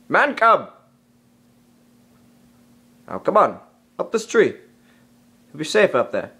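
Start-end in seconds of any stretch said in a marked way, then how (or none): 0.1-0.7 calling out. Acted. Bagheera calling for Mowgli.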